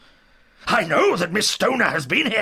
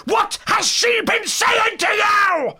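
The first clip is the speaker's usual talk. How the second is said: slow, high, full-voiced scream; enraged old man shrieking his demand; furious scream; 10 dB louder, slower than usual. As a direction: scream every word in rage. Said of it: "screamed the old man furiously".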